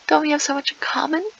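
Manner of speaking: high, fast fake cheer; sarcastic chirpy. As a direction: chirp it with mock sweetness.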